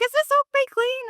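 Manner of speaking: very high put-on visitor voice; imitated older lady. Older lady questioning cleaner at home, high imitated voice. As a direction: imitate a client piping up, pitched far above your own.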